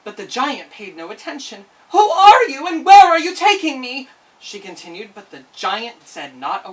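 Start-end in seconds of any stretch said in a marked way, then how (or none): 1.9-4.1 high, loud terrified demand; girl shouting at her captor; frightened shout. Acted. Frightened girl held by giant.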